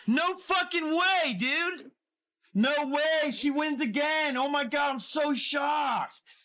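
sarcastic disbelief shouted at the screen; sarcastic fake shock. Real, not acted. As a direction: overplay the shock to show you saw it coming. Sarcastic reaction to losing again.

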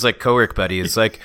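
usual speaking voice

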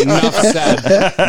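very slow, laughing; slow laughing drawl. Pretend. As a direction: stretch it out with a laugh. Drawn-out joking repeat.

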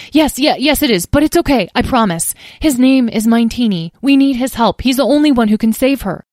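rushed. A narrator's reading. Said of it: "Tom rushed to explain".

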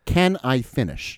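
slow, drawn-out exasperated demand; exasperated insistence. Retold or imitated. Deposed witness mimicking an interrupted exchange, slow and exasperated. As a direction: spell it out slowly, like a fed-up spouse. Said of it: "like a bitter wife having an argument".

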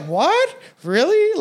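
high, incredulous what-really; shocked disbelief. Retold or imitated. Retelling the comments, high shocked disbelief. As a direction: recount hearing something unbelievable.

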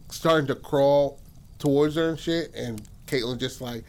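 ordinary delivery

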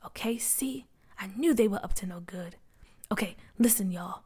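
hushed whisper to the crew. Acted. McKinley hiding behind cars, briefing the crew, whispered. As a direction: keep it secret, barely breathed. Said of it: "McKinley whispered to them".

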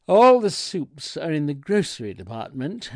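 loud opening, as if talking over deafness; shouting deaf clerk. A narrator's reading. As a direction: boom the answer at the customer. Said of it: "matched his volume".

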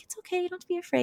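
high, soft comforting voice; retold reassurance. Lara reassuring hesitant Victor, soft imitated comfort. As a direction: gently coax a shy child, imitated.

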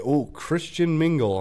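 a lightly voiced cheery pitch; mock ad voice. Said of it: "things like".

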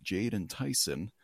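ordinary delivery

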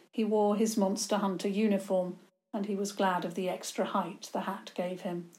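ordinary delivery, nothing marked

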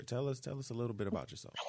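quick soft whisper. Acted. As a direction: whisper the question fast. Host prompting guest softly.

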